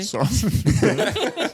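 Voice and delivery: laughing question with a rising end. Real, not acted. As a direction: laugh through the words. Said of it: "what did you just say".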